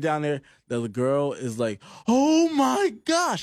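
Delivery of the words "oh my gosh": high, slow, overdone lady's gasp; dramatic gasp. Retold or imitated. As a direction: ham up a shocked lady. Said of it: "she absolutely sold it".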